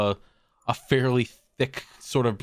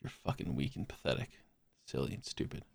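muttered self insult; 10 semitones lower, 14 dB quieter than usual. Real, not acted. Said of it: "self-insult muttered low".

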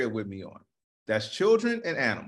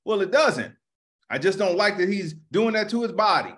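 louder, higher huffy defense; defensive mock; 6 semitones higher, 4 dB louder than usual. Playing a busybody defending his complaint, louder. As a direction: sound petulant and defensive. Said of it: "And I'm like".

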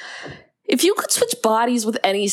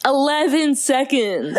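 slow, higher, through laughter; laughing, drawn-out repeat; laughing disbelief; 6 semitones higher, slower than usual. Real, not acted. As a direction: draw it out while cracking up. Laughing over the repeated line.

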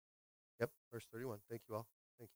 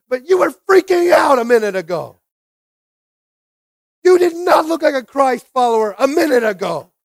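loud, raised preacher's challenge; shouted rebuke; 5 semitones higher, 6 dB louder than usual. Real, not acted. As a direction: call them out at full force. Preacher scolds the congregation, calling out.

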